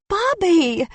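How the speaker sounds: high, loud delighted squeal of "Bobby!". A narrator's reading. Maybelline squealing as she hugs Bobby. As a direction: shriek his name in joy. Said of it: "I squealed".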